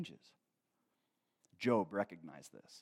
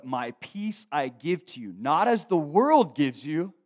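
slow, loud, rising proclamation; preaching scripture; 5 semitones higher, 12 dB louder, slower than usual. Acted. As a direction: proclaim the verse to the whole room.

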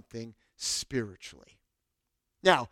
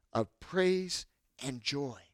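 ordinary talk

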